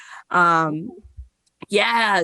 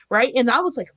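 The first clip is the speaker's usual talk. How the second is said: ordinary talk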